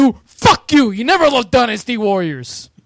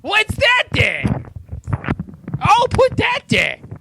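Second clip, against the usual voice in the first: much higher, slow cartoonish whine; weird random voice; 12 semitones higher, slower than usual. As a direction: stretch it out high like a silly character. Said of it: "I was just doing a weird random voice".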